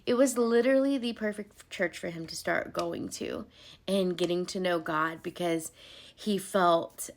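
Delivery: ordinary delivery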